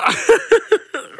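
laughing at own joke; high, loud cackling laugh; high burst of laughter. Real, not acted. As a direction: crack up at your own joke.

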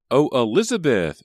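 high, loud cry to her sister; excited cry. A narrator's reading. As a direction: call out, bursting with excitement. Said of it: "she cried".

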